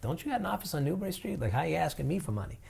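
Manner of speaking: high, fast and soft sneer; mocking donor voice. Donor sneering at a charity on Newbury Street, high quick mock. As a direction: scoff at the fancy office, quick and light.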